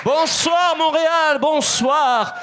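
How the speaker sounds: high, drawn-out shout to the audience; calling out greeting. Real, not acted. Comedian greets the crowd on entering. (salutation lancée au public, voix haute)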